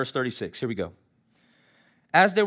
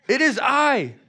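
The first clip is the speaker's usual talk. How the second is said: voicing Jesus; 7 semitones higher, 4 dB louder, slower than usual. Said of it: "I love how Jesus goes".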